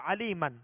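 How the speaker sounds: "علی من" ending on a sounded n; tanween stop. Shown as a demonstration. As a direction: stop on "علی من" with the final -an pronounced. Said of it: "تنوین پڑھ کر ہم نے وقف کیا".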